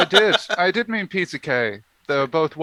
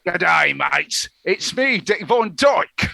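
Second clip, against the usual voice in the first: hammed-up Australian accent, slow on the name; bad Aussie impression, drawn out at the end; mock Aussie accent introducing himself, slowly; 4 dB louder, much slower than usual. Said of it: "my Australian accent is same as ... my Cockney accent".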